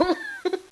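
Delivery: a short laugh that squeals up high; high squealing laugh. Real, not acted. Laughs at own memory.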